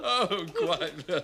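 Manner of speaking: agreeing through laughter; high, giggling assent; laughing. Acted. Friend agreeing after the Vietnam story, laughing.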